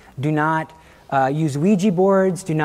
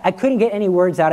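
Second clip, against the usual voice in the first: ordinary talk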